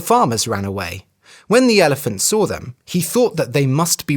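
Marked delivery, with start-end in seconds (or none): none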